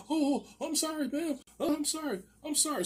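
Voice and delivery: high-pitched, pleading imitation; mock panicked apology. Neighbour apologizing to the woman with a gun, mock panicked. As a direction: beg forgiveness in a squeaky put-on voice.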